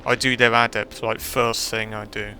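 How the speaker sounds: usual speaking voice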